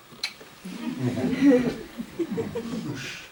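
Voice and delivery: airy unvoiced chuckle; breathy laugh. Real, not acted. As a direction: laugh at his own boast. Laugh after boast.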